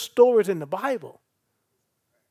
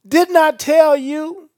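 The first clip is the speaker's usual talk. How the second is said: louder, slow, higher "Didn't I tell you?"; emphatic Jesus quote; 8 semitones higher, 10 dB louder, slower than usual. Acted. Preacher quoting Jesus to Martha, loud and slow. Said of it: "Look at Jesus' response".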